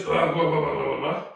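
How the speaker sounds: run-together blah-blah noise; gruffly babbled scolding; correcting voice. Shown as a demonstration. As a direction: mimic a telling-off in blahs. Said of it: "a voice that goes".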